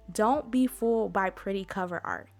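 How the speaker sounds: ordinary talk, nothing marked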